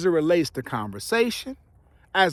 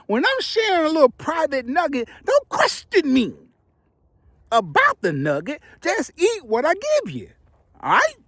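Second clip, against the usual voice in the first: high, mock-stern teasing; playful scolding; 10 semitones higher than usual. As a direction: tease the audience for prying. Playfully scolds nosy viewers.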